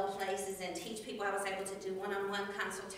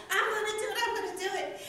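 high, loud and eager with a laugh; pumped up; 9 semitones higher, 7 dB louder, faster than usual. Retold or imitated. Speaker recalling her Olympic dreams, pumped. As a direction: psych yourself up. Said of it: "And I was like".

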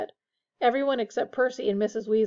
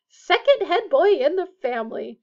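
loud, higher motherly boast; swelling with pride; 7 semitones higher, 8 dB louder than usual. A narrator's reading. Missus Weasley shows off Percy's new badge, proud. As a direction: announce it proudly. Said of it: "swelling with pride".

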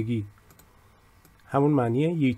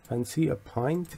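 soft, slow offer; 8 dB quieter, much slower than usual. Shown as a demonstration.

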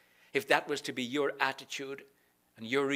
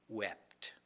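whispered wept, lower and softer; 6 semitones lower, 10 dB quieter than usual. Acted. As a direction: breathe the word wept. Reading Jesus wept.

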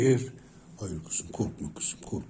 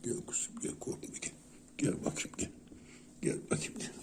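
hushed, coaxing whisper; 3 semitones higher, 8 dB quieter, faster than usual. Real, not acted. Owner whispering to coax her frightened pet closer. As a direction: breathe the words so she won't spook.

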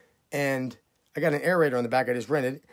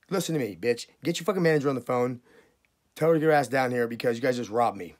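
ordinary talk

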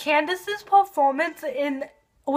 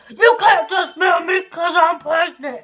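louder, heated quote of the character; hammed-up indignant shout; mock shout; 3 semitones higher, 4 dB louder than usual. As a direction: throw the accusation out. Acted quarrel from the episode.

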